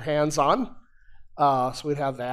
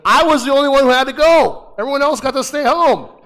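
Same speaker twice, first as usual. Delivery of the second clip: mock outrage; 10 semitones higher, 10 dB louder than usual. Indignant childhood complaint.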